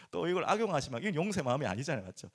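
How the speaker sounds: usual speaking voice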